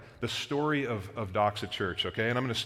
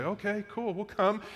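high, lightly imitated easygoing yes; friends agreeing; 9 semitones higher than usual. Friends agree to join the church move, high casual imitation. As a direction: pitch it up as friends casually signing on. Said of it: "they were just like".

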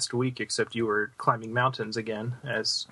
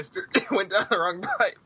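speaking through a cough; coughing mid-sentence; choking cough; 8 semitones higher than usual. Real, not acted. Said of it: "Yes, I'm done choking now".

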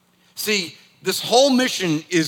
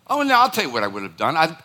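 ordinary delivery

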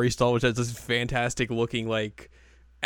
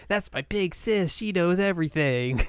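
higher, drawn-out proud little sister; voiced Ruby; 7 semitones higher than usual. Ruby bragging to everyone about her big sis, voiced higher.

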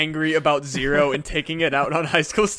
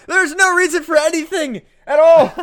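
high, louder existential rant; mock freak-out; 9 semitones higher, 7 dB louder than usual. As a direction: spiral into a playful freak-out. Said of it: "I'm gonna go on a fucking freak out".

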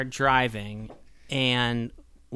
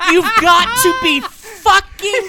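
exasperated yelling; 10 dB louder than usual. Real, not acted. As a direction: yell it in laughing disbelief. Exasperated at the Hey Cow game.

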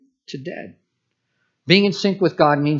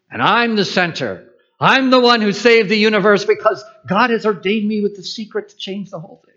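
mock grandiose hero; 7 semitones higher, 4 dB louder than usual. Mocking self-centered hero fantasy.

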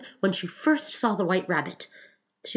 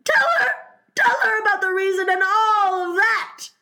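very high, loud, strained wail; yawning Mock Turtle; 6 dB louder than usual. Mock Turtle passes the tale to the Griffin, high loud wail. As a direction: cry it out high, stretched through a yawn.

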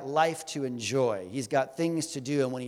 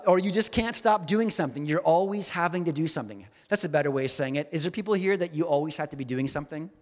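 ordinary delivery

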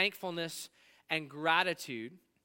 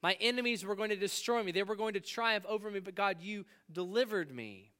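a hint of David crying out to God, raised; voicing David's prayer; 6 semitones higher, 3 dB louder than usual. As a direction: speak as David in earnest thanks. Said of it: "And so David is saying".

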